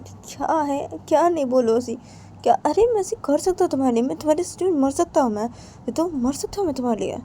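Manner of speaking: high-pitched protest of devotion; impassioned pleading. Acted. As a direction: plead your love in a high voice, no shouting. Acted impassioned pledge to die. (ऊँचे सुर में भावुक मिन्नत)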